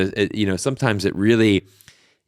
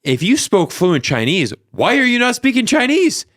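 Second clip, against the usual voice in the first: exasperated challenge; 13 semitones higher, 4 dB louder than usual. Retold or imitated. Vlogger calling out the reporter on video, exasperated. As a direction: pitch climbing to a high, incredulous question.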